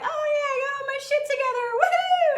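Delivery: very high, fast mocking squeal; social media show-offs. Host mimicking people on social media, mocking glee. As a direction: brag giddily in a silly high voice. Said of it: "other people on social media being all like".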